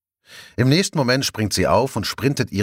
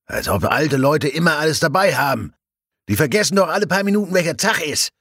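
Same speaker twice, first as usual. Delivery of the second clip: higher and fast, tossed back over the shoulder; dismissive doctor; 8 semitones higher than usual. A narrator's reading. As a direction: brush her off, talking quickly. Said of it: "gibt der Leichenbeschauer über die Schulter zurück". (abfällig über die Schulter)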